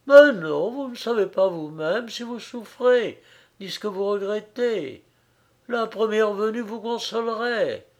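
impassioned reproach. A narrator's reading. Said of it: "la jeune personne s'exaltait". (reproche exalté, d'une voix plus aiguë)

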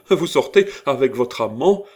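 high, loud, scandalised question; outraged outcry. A narrator's reading. Old lady accusing girl of leaving with lover, outraged outcry. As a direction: exclaim it in open outrage. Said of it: "s'écria la vieille dame".

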